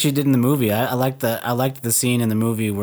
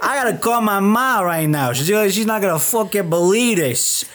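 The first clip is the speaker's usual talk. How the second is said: much higher, hammed-up New York Italian accent; New York Italian guy; 6 semitones higher than usual. Joking about her New York roots, hammed-up New York Italian accent. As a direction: play Uncle Tony's outraged nephew in a thick New York accent. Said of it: "That's New York".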